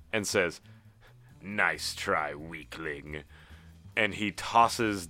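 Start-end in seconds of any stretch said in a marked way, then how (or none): 1.4-3.2 sneering. Acted. Leader sneering at Geralt.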